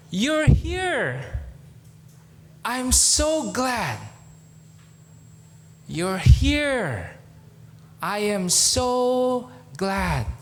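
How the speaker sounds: high, slow and glowing with joy; smiling welcome. Retold or imitated. Older woman hugging Pete at the church door, joyful. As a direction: greet him like a long-lost son. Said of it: "she smiled and said".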